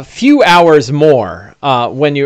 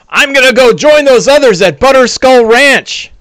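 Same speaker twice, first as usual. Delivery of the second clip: high-pitched orc voice, louder, running away; fleeing orc; 10 semitones higher, 3 dB louder than usual. Acted. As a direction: yelp it out over your shoulder as you flee. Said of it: "he says in Orcish".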